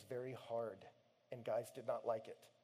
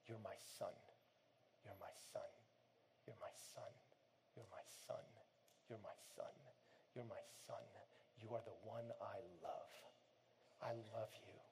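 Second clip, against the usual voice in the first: tender reassurance; 4 semitones lower, 14 dB quieter than usual. Acted. God speaking to his struggling son, soft tender whisper. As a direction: whisper it like a loving father.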